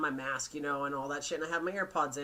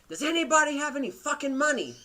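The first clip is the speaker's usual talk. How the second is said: rude lady yelling; 8 semitones higher, 4 dB louder than usual. Retold or imitated. Retells rude lady yelling on bus.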